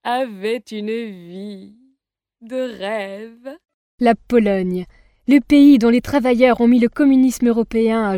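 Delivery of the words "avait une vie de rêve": soft, slow storybook telling; hushed once-upon-a-time voice; fairy tale narration. A narrator's reading. Fairy tale read softly over music.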